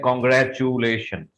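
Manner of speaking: Congratulations said slowly with a full "choo"; common mispronunciation. Shown as a demonstration. Teacher quoting what people normally say, mispronounced. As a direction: draw out "grat-choo-lay" in Congratulations. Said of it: "normally लोग बोलते हैं".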